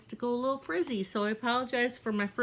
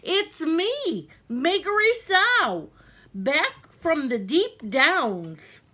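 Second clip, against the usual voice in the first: high, loud, slow proud announcement; shouted; 7 semitones higher, 5 dB louder, slower than usual. Acted. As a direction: call out your name across the kitchen. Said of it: "she shouted".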